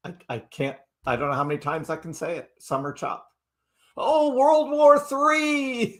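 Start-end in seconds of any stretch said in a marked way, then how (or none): 4.0-6.0 high, very slow wail of fake dread; mock alarm. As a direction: draw it out high like a hammed-up doomsayer. Mocking alarm over war news.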